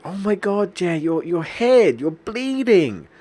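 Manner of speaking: high, loud, slow gasp of alarm; freaked out receptionist. Retold or imitated. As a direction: shriek it like she just saw blood. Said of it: "she kinda freaked out".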